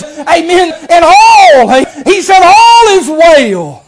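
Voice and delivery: high, loud, drawn-out preaching climax; full-force preaching. Acted. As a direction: build the congregation up to a triumphant shout. Preaching climax, rising to shouts.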